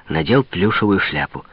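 ordinary delivery

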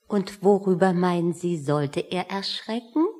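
ordinary delivery

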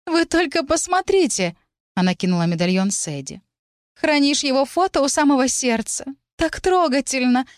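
fake sweet teasing. A narrator's reading. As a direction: tease in a fake sweet little voice. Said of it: "поддразнила она притворно милым голоском".

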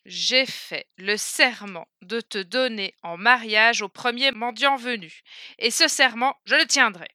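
plus fort et lent, le roi tranche sans appel; serment solennel. Acted. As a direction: proclamez le serment comme un roi inflexible. Le roi impose son serment. (voix royale forte et lente, qui ne cède pas)

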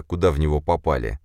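usual speaking voice

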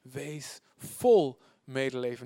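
"vol" drawn out slowly and weightily; stress on VOL. Shown as a demonstration. Reader meditating on the verse, slow stress on "vol". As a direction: lean long on "vol". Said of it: "Niet half".

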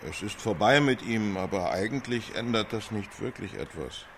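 head down, voice dropping away into resignation; downcast, eyes on the floor. Acted. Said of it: "Sieh dabei auf den Boden vor dich".

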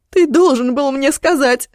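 high loud hysterical accusation. Acted. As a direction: shout it out in hysterics. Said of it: "Голос её звучал истерично".

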